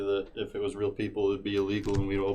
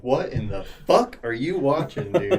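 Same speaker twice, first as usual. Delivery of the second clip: slow, raised incredulous question; imitated disbelief; 4 semitones higher, 4 dB louder than usual. Imagined girlfriend catching him watching gory anime, mock disbelief. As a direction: draw it out in mock shock.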